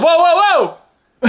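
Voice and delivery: high, loud imitation of a panicked child; alarmed kid. Daughter stopping dad from seasoning her eggs, alarmed mock shout. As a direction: shout it high to halt someone mid-action.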